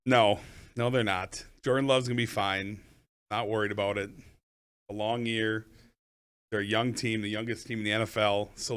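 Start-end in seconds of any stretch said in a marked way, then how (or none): none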